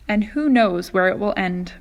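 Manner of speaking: ordinary delivery, nothing marked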